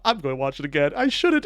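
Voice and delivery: normal talking voice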